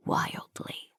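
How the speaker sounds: intimate, barely voiced whisper. A narrator's reading. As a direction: whisper it right up close. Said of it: "he whispers against my lips".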